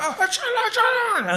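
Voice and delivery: high, frantic Indian servant shooing; shooing the monkey. Retold or imitated. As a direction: chase the monkey away with a high voice. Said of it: "the Indian was going".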